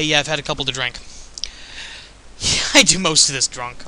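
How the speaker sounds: ordinary talk, nothing marked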